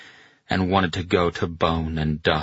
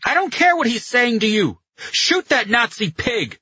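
high, loud, heated yelling; impatient yell; 4 dB louder than usual. A narrator's reading. Carletto demands Pino ignore the general and fire, yelling. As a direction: yell over the other man's excuses. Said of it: "Carletto yelled".

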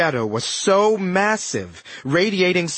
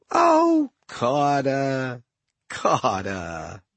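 mildly disappointed; 3 semitones lower, much slower than usual. A narrator's reading. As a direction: chide him with mock pity, stretching each name. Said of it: "as if he were mildly disappointed".

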